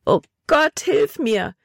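high-pitched plea for help; desperate cry. A narrator's reading. Trapped Libby begs God for help, desperate cry. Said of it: "rief sie verzweifelt".

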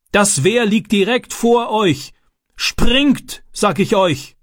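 high, insistent warning call; urgent shouted command. A narrator's reading. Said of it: "rief der Mann". (dringlich gerufene Warnung, hohe Stimme)

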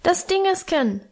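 put-on high little-boy peep; small child's high squeak; piping child. A narrator's reading. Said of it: "piepste Hermann".

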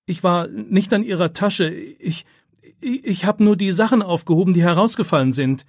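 high, flustered self-defence with stumbled restarts; stammering protest. A narrator's reading. Pupil defends himself to the accusing teacher, stammering. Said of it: "stammelt er".

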